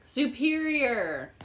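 drawn-out triumphant recall. Real, not acted. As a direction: slowly pull out "Superior" as if just remembered. Straining to recall lake name.

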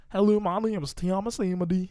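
exaggerated Southern impression of the co-host; Southern accent. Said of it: "all about that southern accent".

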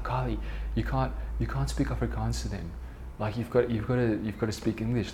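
quiet, insistent telling-off of a little sister; hushed urging. Retold or imitated. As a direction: urge her softly, keeping it under your breath. Urging little sister among her friends.

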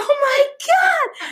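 high, slow, laughing exclamation; laughing cry. Real, not acted. Laughing with her friend over mom's praise, high and slow.

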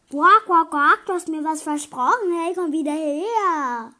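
loud croaking shout; frog calling after someone. Acted. Frog to the fleeing princess, indignant loud call. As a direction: croak loudly, then holler after the runaway.

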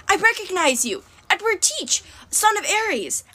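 high, astonished exclamation; gasped. A narrator's reading. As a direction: blurt out a startled recognition. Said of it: "Annabeth gasped".

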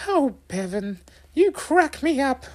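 very high, tickled mother voice; amused mum. A narrator's reading. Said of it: "Mum chuckled".

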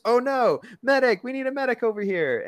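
high, loud, panicked rat voice; Rizzo calling for a medic. Retold or imitated. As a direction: shout for a medic in a squeaky cartoon voice. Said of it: "And Rizzo the Rat goes".

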